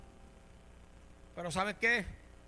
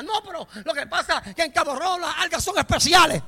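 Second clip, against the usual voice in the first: loud, higher mock insistence; acted squabble; 6 semitones higher, 14 dB louder than usual. Contrary churchgoer defending Cabo Rojo's algae, mock indignant. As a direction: insist on it in a hammed-up shout. Acted quarrel over which beach.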